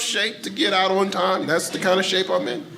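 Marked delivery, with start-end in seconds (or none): none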